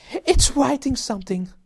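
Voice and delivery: hysterical scream. Acted. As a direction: scream in terror at the moving chalk. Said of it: "screamed Nigel".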